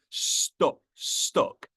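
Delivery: "stuck" with its U said as "uh"; regional U in STUCK. Shown as a demonstration. Apologising for the accent, "stuck" shown with its regional "uh". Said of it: "Sorry for my accent. ... the U is a, uh".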